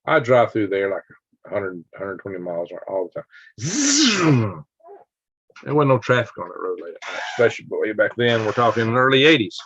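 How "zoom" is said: high buzzing zoom that slides down; speeding-car sound effect. Shown as a demonstration. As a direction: imitate a car whizzing past. Mimics car racing through.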